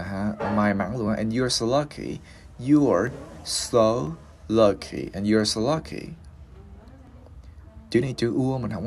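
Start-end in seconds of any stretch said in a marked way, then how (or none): 2.7-5.1 "You are... so... lucky" drawn out with pauses; slow teaching model, each word set apart. Shown as a demonstration. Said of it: "Bây giờ mình đi lướt cái câu này". 5.1-6.1 "and you are so lucky" linked in one smooth phrase; glided through. Shown as a demonstration. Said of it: "Bây giờ mình đi lướt cái câu này".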